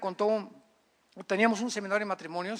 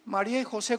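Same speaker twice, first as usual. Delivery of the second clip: usual speaking voice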